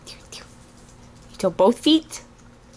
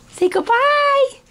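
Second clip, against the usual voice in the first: put-on high baby talk; 12 semitones higher than usual. Baby says goodbye.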